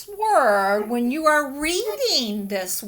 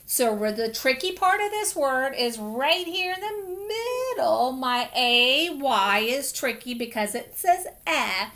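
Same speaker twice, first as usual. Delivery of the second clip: normal talking voice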